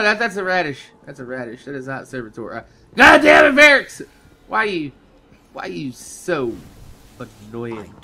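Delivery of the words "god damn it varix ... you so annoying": loud outburst trailing to slow whine; loud mock-angry yell, fading; mock exasperation. Real, not acted. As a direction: yell at the robot. Yelling at NPC Varix.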